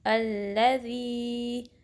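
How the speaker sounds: अल्लज़ी recited slowly with ज़ी held for two counts. Shown as a demonstration. Teacher recites अल्लज़ी slowly for students. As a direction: draw out अल्लज़ी like Quran recitation. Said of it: "तो इसको ऐसे पढ़ेंगे".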